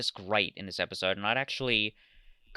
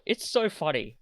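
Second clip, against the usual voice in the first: ordinary talk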